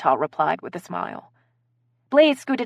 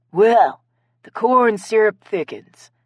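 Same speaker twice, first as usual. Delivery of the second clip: slow, louder, snide comment; 3 semitones higher, 6 dB louder, slower than usual. A narrator's reading. Guest needles the others as suspicion grows, snide. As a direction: draw it out with snide relish. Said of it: "Blaze said snidely".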